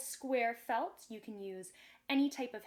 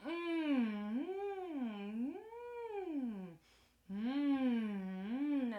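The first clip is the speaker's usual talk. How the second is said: long, swooping "mmm" while pretend-chewing; yummy chewing hum; much slower than usual. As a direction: savour it with drawn-out hums. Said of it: "as I chew my gum".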